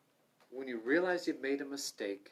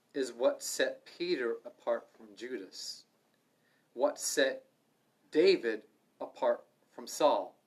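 slow emphasis; 6 dB louder, slower than usual. Acted.

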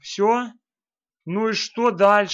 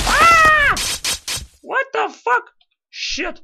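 startled yell; 5 dB louder, much slower than usual. Real, not acted. Startled in game.

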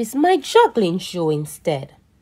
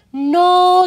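high loud forbidding no; firm no; 10 semitones higher, 8 dB louder than usual. Acted. Grandpa forbidding decorating, a high loud no. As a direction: stop the child cold.

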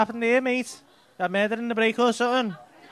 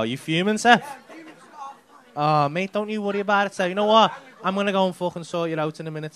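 usual speaking voice

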